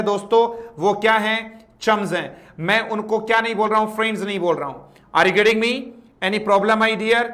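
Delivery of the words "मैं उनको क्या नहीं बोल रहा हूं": usual speaking voice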